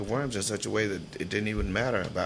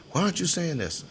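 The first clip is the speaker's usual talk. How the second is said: teacher demanding; 10 semitones higher, 5 dB louder than usual. Retold or imitated. Teacher confronts him in class.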